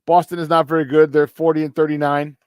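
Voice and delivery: ordinary talk, nothing marked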